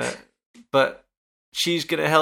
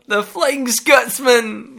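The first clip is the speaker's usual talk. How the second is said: playful exclamation; 9 semitones higher, 5 dB louder, much slower than usual. Pretend. Joking about fake Scot.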